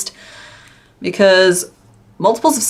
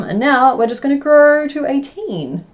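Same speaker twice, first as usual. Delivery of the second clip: usual speaking voice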